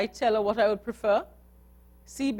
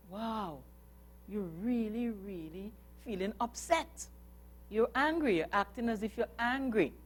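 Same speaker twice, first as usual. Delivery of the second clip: gentle parent; 3 semitones higher, 7 dB quieter than usual. Pretend. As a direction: soothe an upset child softly. Parent calming upset child.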